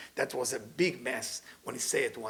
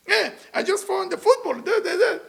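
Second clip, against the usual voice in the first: high, louder da da da of a sports fan; mock husband babbling sport; 5 dB louder than usual. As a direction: parody the husband going on about the game. Husband voiced rambling about sport.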